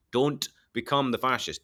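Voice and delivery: ordinary delivery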